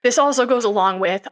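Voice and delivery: ordinary delivery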